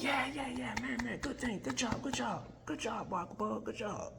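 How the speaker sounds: high squeaky crowd voices; high-pitched cheering bystanders. Retold or imitated.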